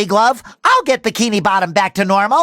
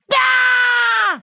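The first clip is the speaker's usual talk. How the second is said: long high-pitched yell; high cartoon scream; 11 semitones higher than usual. Hero cries out mid-adventure, long high scream.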